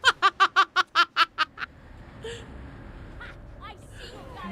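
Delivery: real laugh, loud bursts tailing off. Real, not acted. Streamer amused by the Zealot's line, laughing out loud.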